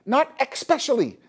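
"especially" said as ek-special-ly; wrong pronunciation. Shown as a demonstration. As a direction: stick a k into "especially". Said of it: "it's not especially".

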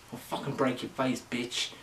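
normal talking voice

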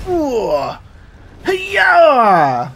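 high, loud attacking shout; battle cry. Real, not acted. Player striking the boss in the face, battle cry. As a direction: let out a big fighting yell.

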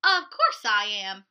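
loud, proud Sebastian; proud reply. Acted. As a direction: declare it with pride. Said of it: "replied Sebastian".

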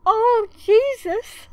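1 giddy disbelief. Real, not acted. Overjoyed pull.

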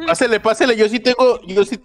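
high loud vendor patter; hyped fairground barker. Retold or imitated. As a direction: beckon customers in eagerly. Vendor calling out wares.

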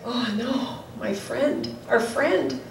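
hushed, understated grief; quiet mock-tearful lament; tearful grief, retold. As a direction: softly voice a friend's shocked loss. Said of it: "tears were welling up inside of them".

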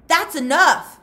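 very loud and high, cutting in; yelled. Acted. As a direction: yell to stop the fight. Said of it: "Mister Pendanski yelled".

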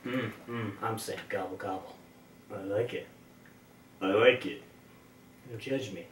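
very low and soft; hushed confession. Real, not acted. Player owning up to saying gobble, hushed.

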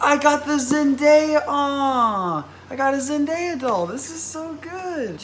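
high, gushing thrilled voice; high-pitched gushing delight; delighted squeal. Real, not acted. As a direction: coo over the doll with joy. Delighted by [name] doll.

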